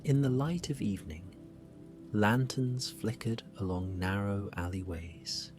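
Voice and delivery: plain reading voice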